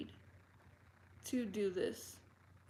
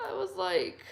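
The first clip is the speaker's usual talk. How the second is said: laughing; 10 semitones higher, 6 dB louder than usual. Real, not acted. Laughing at the date request.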